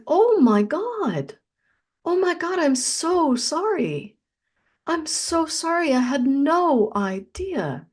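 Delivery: high, slow, tearful plea as remembered; retold sobbing apology. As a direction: relive the sobbing while apologizing. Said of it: "I just started sobbing".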